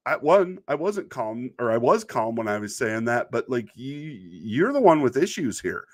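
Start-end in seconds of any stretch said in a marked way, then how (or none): none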